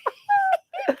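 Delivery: high giggle; high laugh. Real, not acted. Laughing at own uptalk.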